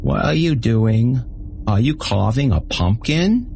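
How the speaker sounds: put-on high, slow mumbled baby voice. A narrator's reading. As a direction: mumble it like a whiny little kid. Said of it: "Bait mumbled in a baby voice".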